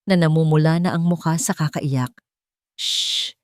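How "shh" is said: unvoiced shush to a crying baby; hushing shush. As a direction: hush the baby gently. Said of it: "bulong ni Esteban".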